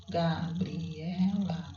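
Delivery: very slow Gabriela, syllables pulled apart, falling at the end. Shown as a demonstration. Teacher counting syllables with the class, slow and separated. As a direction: spell out Gabriela by ear, one beat per syllable. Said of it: "Quantas sílabas?".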